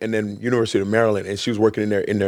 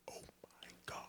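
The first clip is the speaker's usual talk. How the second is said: stunned whisper; 35 dB quieter, much faster than usual. Retold or imitated. Retold stunned reaction.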